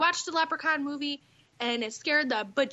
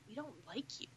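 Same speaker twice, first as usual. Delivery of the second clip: hushed punchline; 3 semitones lower, 17 dB quieter than usual. Real, not acted. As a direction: drop to a near whisper. Punchline dropped under breath.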